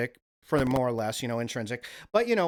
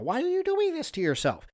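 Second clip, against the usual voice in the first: mock whiny self-scold. Mock self-reproach, high squeak.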